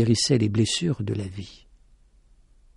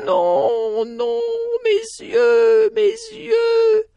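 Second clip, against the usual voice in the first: moaning cry; much slower than usual. A narrator's reading. Blind lady moans for her eyes, high crying. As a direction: wail in fear for the eyes. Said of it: "Il l'entendait gémir".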